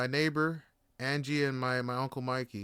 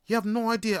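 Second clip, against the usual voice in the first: ordinary delivery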